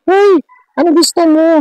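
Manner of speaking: squeaky high little dwarf calling out; tiny dwarf voice. Retold or imitated. Dwarf calls out to the child, high and squeaky. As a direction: pitch way up like a tiny playful duwende.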